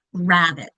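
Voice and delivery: RABBIT as RAB-it, first syllable stronger, falling; stress on first syllable. Shown as a demonstration. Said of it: "which syllable is said louder".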